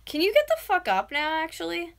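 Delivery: high, loud demand; yelling. Real, not acted. Fed-up girlfriend waking boyfriend on stream, yelling. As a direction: yell at him to move. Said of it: "I normally yell like this".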